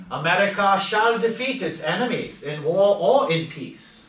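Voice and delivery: FDR impression. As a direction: ring it out like a wartime president. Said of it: "you hear a more British accent".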